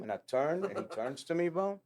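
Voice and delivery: normal talking voice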